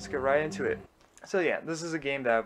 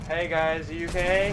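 calling out; 6 semitones higher, 5 dB louder than usual. Pretend. Calling to fellow crash survivors.

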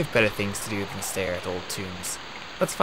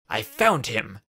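louder, urgent insistence; more urgent; 2 semitones higher, 7 dB louder, slower than usual. A narrator's reading. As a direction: press it on him, louder now. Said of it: "in a more urgent voice".

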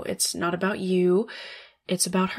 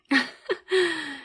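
short high falling cackle; a quick smug villain cackle; high mocking cackle; 10 semitones higher than usual. Boss mocking her new assistant, high cackle.

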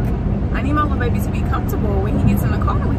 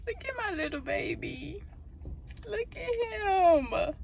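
cooing at puppy; 9 semitones higher, 12 dB quieter, slower than usual. Real, not acted. Cooing over new puppy.

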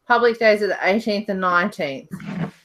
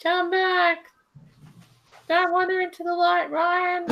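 high-pitched playful plea, held up high throughout; high, sing-out mock pleading; mock calling out; 10 semitones higher than usual. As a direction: call someone back in mock distress. Playful calling after vanished Ryan.

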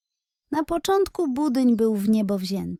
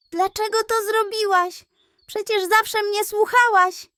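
much higher, hurt disbelieving voice; bewildered pug; 11 semitones higher, 3 dB louder than usual. A narrator's reading. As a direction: demand an answer, wounded. Hurt pug questions the pig.

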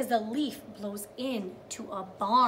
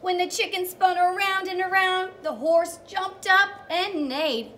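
higher, louder read-aloud; a teacher reading the big moment to young children; storytime reading; 9 semitones higher, 7 dB louder than usual. Acted. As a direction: sing out the page to the kids. Reads storybook aloud to kids.

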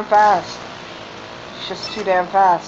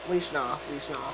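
low, very soft plea; small, quiet begging; hushed pleading; 5 semitones lower, 14 dB quieter than usual. Real, not acted. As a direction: plead under your breath. Muttered dread while racing.

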